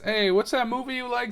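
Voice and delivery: a hint of a grown-up quizzing a child; asking adult. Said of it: "when I was, like, six".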